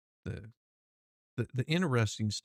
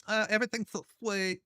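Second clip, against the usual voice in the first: high, mock-dainty voice; hammed-up high girly voice; mock sweet voice; 9 semitones higher than usual. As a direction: imitate him all sweet and dainty.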